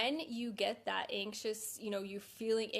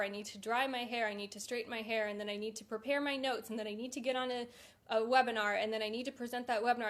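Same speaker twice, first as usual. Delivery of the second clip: rushing through the list, faster as it goes; racing to-do list. Retold or imitated. Recalling anxious dog walks, rattling off chores faster and faster. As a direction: rattle the chores off like a mind that won't stop. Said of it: "I would be like".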